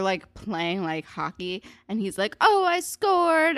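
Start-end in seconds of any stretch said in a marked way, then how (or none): 2.4-3.6 high, loud, drawn-out crowing; mock boasting. Toby crowing over his hockey goal, mock boasting. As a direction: imitate an obnoxious victory cry. Mocking Toby's boast after kiss.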